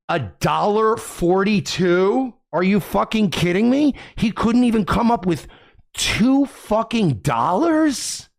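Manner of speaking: slow, rising, scandalized questions; incredulous outrage. Real, not acted. Streamer scoffing at a broke viewer's tiny donation, incredulous outrage. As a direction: stretch the amount out in high disbelief. Disbelief at a tiny paid message.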